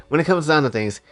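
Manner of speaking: ordinary delivery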